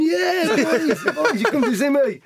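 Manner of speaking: high, loud comic impression; Peter Kay. Footballer joking about boys coming to watch him, Peter Kay impression. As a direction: crow it high and loud, half laughing. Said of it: "I was like Peter Kay".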